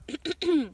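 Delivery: clears throat loudly; nervous loud throat clear. A narrator's reading. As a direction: sailor clearing throat before speaking. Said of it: "cleared his throat".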